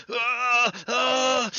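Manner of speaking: high pained scream; agonized scream. Acted. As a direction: scream out in agony. Cooper screams in the fit. (cri de douleur)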